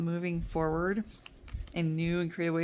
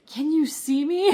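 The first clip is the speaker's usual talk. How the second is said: much higher, louder, stunned; amazed shout; 11 semitones higher, 9 dB louder than usual. Retold or imitated. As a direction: cry out like you've been caught. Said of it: "one little thing where I'm like".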